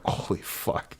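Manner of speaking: breathy whispered "Holy fuck", hushed in shock; retold whisper. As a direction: whisper it, stunned, as if not to be heard. Retold gasp at nude man.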